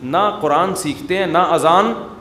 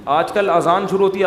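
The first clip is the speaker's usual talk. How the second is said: ordinary talk, nothing marked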